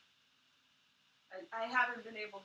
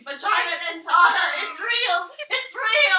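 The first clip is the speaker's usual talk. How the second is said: high, very loud comic shriek; mock scream; 7 semitones higher, 17 dB louder, slower than usual. Shown as a demonstration. Friend screaming to make Red laugh, mock scream. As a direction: scream it like a hammed-up warning. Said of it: "wave my hands around and scream".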